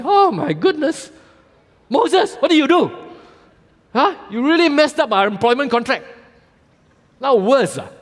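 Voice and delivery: high, loud mock-exasperated complaint; exasperated Israelites. Israelites complain to Moses about doubled work, high mock-exasperated whine. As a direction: whine at Moses like a crowd betrayed.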